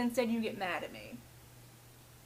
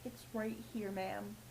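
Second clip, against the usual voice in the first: deadpan reply; 4 semitones lower, 11 dB quieter, slower than usual. Retold or imitated. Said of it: "reply to customer".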